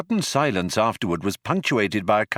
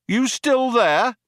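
high, slow, drawn-out call; 14 semitones higher, 3 dB louder, slower than usual. A narrator's reading. Cop checks on the group after firing, called out. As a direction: call across to unseen people. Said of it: "called one of the cops".

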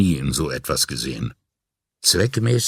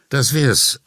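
raised pitch, understated relief; audibly relieved; 7 semitones higher, 4 dB louder than usual. A narrator's reading. Marillo wraps up the job, mildly relieved. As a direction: let a touch of relief show. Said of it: "sagte Marillo hörbar erleichtert".

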